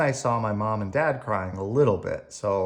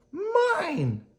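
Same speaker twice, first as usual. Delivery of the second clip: very high crying mine; whiny toddler. Acted. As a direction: cry out over a toy. Toddler fighting over toy.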